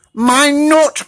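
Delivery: high, loud triumph; triumphant shout. Acted. Pecan winning the race, high triumphant shout. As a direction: crow it in triumph. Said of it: "shouted Pecan triumphantly".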